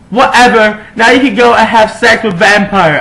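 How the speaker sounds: high, loud angry yell; loud bratty yell; shouted. A narrator's reading. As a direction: hurl it back as you storm off. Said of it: "I shouted".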